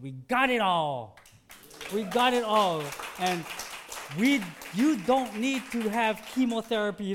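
we got it all, ringing out; doctor's triumphant news. Retold or imitated. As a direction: shout out the good news like the doctor did. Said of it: "They examined it and said".

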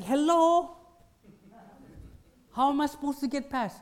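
high-pitched annoyed shopper, half mocking; exasperated hello. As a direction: throw up your hands at the worker's wagon. Acted exasperated shopper at blocked aisle.

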